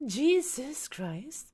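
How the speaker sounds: very slow, stretched-out oath; drawn-out exasperation. Real, not acted. Streamer who cannot with the chat, drawn-out exasperation. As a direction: drag out each word in exasperation.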